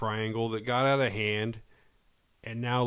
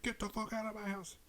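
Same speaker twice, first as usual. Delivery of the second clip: mock freak-out; 11 semitones higher, 11 dB quieter than usual. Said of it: "She freaks out on him".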